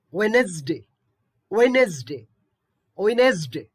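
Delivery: Wednesday said "Wenesday", d dropped; Wednesday, silent d. Shown as a demonstration. Teacher drills Wednesday for students, d silent. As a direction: drop the d in Wednesday. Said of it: "we have to pronounce it as".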